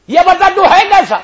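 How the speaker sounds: high, loud ringing question; loud challenging question; sudden loud call. Acted. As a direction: burst out in a loud voice. Imam presses the pilgrim.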